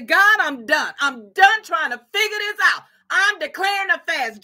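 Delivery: loud, high cry to God; impassioned preacher acting out a resolve; fed-up declaration.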